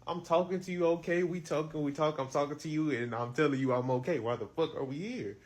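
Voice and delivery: loud, high, heated retold yelling; angry yell. Son yelling at mom outside the hospital, loud and angry. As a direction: shout it back at her, fed up.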